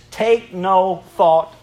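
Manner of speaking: very slow, weighty reading; solemn King James phrase read slowly; slow scripture quote. Acted. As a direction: lay each word down deliberately. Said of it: "It says".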